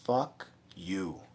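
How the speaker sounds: quiet, low contempt; low, hushed telling-off. Pretend. To Mister Parsons, a low hushed fuck you. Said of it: "I would say".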